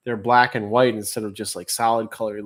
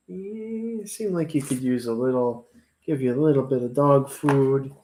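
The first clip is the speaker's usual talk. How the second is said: talking to pets. Real, not acted. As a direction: coo gently to the animals. Talking to pet roaches.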